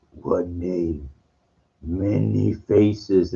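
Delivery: lightly voiced deity, drawn-out words; slow, solemn hint of an all-powerful being; almighty God. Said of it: "the almighty, all-powerful".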